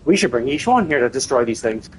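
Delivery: normal talking voice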